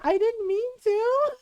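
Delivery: high, loud mock-innocent plea; playful plea. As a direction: squeak it out like a caught child. Wife pleads playfully to husband.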